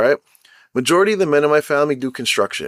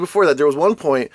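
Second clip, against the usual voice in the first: ordinary delivery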